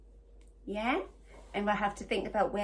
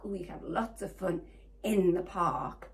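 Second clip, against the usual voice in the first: ordinary delivery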